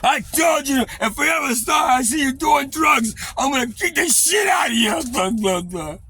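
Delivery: very high, loud threatening yell; imitating brother's threat. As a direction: bark the warning.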